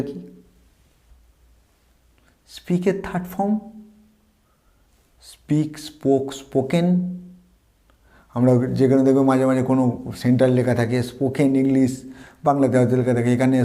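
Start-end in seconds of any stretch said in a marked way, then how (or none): none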